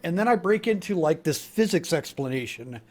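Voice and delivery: ordinary talk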